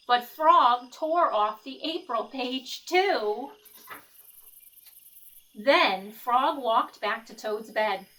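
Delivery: lively picture-book read-aloud; reading to children. A narrator's reading. Teacher reading Frog and Toad to the class, lively storytelling. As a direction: read it like story time for kids.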